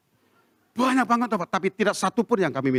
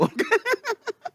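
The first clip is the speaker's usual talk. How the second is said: high burst of laughter; laughing; 4 dB quieter than usual. Real, not acted. Pastor laughing at scared neighbours, high laugh. Laughs at his own story.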